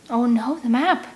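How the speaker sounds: sudden panicked cry, pitch jumping up; alarmed gasp. A narrator's reading. Girl realizing the map is gone, rising alarm. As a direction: blurt it as the loss sinks in.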